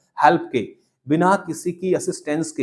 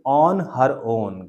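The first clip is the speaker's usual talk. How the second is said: very slow, each word apart; teacher to class: slowly for repeating; slow model phrase; 3 semitones lower, much slower than usual. Shown as a demonstration. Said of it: "हर self को बोलेंगे".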